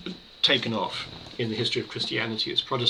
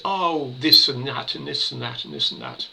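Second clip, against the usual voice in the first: imitating an irritated retort, higher and louder; mock irritated; 3 semitones higher, 5 dB louder than usual. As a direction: reel it off as a mildly exasperated, dismissive imitation. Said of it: "He's a bit irritated and says".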